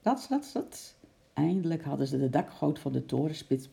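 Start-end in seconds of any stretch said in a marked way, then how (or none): 0.0-0.9 high "Rats, rats" fading to a whispered "rats"; scrambling sound. A narrator's reading. Narrator voices the climb, fading off. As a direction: let the scurrying fade away.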